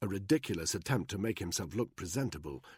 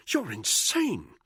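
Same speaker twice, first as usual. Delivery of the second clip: hissed; 12 semitones higher, 5 dB louder, much slower than usual. A narrator's reading. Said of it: "Buckingham hissed".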